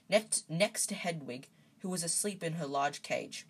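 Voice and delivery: ordinary delivery, nothing marked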